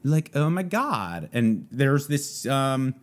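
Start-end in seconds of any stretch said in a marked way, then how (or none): 0.0-1.3 put-on high, slow Valley Girl exclamation. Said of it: "called Valley Girl".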